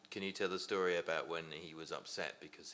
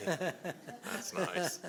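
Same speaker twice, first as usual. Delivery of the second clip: laughing; 12 semitones higher, much slower than usual. Real, not acted. Amused reaction to jam jar joke.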